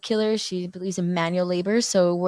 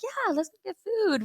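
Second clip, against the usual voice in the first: put-on high-pitched, slow eager kids' yes; 11 semitones higher, 4 dB quieter than usual. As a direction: sing out the yes like a happy kid. Kids eagerly answering mom.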